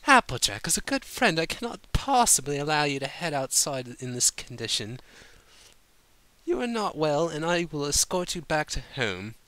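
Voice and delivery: high, refined and firm; firm prim lady. A narrator's reading. Rarity insisting she escort drunk Applejack home, firm and prim. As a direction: lay down the law politely. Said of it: "Rarity said firmly".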